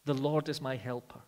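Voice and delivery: full-force preaching. Acted. Preacher pressing duties on the church, full-force preaching. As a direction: drive each duty home to the congregation.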